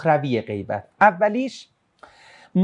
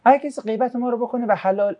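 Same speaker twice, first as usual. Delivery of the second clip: ordinary talk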